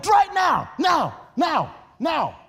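high loud command; commanding shout. Acted. Jesus commanding servants to draw water, loud. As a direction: shout the order to act now. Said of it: "He said".

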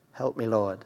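real aside to God, hushed; private plea. Real, not acted. Quick aside prayer mid-reading.

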